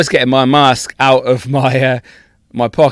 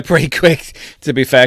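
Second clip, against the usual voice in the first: ordinary talk, nothing marked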